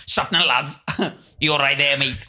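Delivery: lightly mimicked Scouse host greeting listeners; teasing bit of put-on Scouse banter; podcast host impression. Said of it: "Let me do an impression of you".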